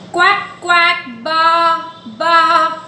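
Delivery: sing-song quacks then baas; playful animal imitations; duck and sheep sounds. Shown as a demonstration. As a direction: chant the animal noises for toddlers. Listening game animal sounds.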